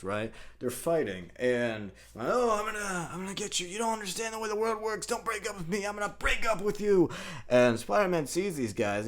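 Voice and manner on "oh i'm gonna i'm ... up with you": put-on high-pitched squabbling teenager voice; hammed-up lovers' spat, pitched way up; mock teen quarrel. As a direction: act out two bickering teenagers.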